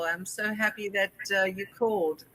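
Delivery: ordinary talk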